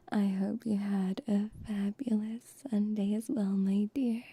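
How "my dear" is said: ordinary talk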